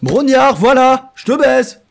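loud, high triumphant shout; triumphant outburst. Real, not acted. Player finally remembers the TV host's name, triumphant shout.

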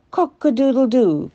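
a high, loud cock-a-doodle-doo; rooster crow. A narrator's reading. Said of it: "crowed the rooster".

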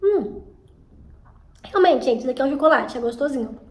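talking while chewing; chewing between words; mouth full of chocolate. Real, not acted. Seen 1: Bites chocolate at -5; lips pursed, chewing-like moves while talking.

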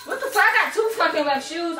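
normal talking voice

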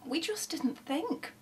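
slow, high, soft contrite voice; murmured apology. A narrator's reading. Robbers own up to the neighbours, murmuring. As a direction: trail into a near-whisper of regret. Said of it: "they murmured".

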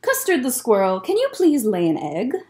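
ordinary delivery, nothing marked

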